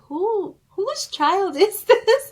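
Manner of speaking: loud, high, puzzled exclaim with a laugh; baffled exclaim. Retold or imitated. Dreamer at her sermon, loud bewildered exclaim. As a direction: blurt out who the child is. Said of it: "but I'm like".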